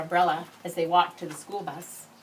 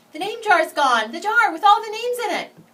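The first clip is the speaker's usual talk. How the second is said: put-on child shouting in alarm, much louder and higher; 13 semitones higher, 12 dB louder than usual. Boy shouting the jar is missing to his teacher, alarmed yell. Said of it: "Ralph shouted at him".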